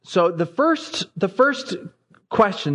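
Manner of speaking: ordinary talk, nothing marked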